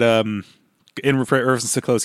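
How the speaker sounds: ordinary talk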